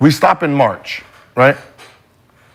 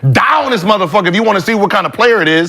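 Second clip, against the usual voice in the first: heated rant; 6 semitones higher than usual. Real, not acted. Heated rant about testing.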